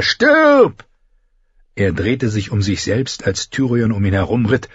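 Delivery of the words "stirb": shrieked high death threat; shouting. A narrator's reading. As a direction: scream it with full fury. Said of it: "schrie er".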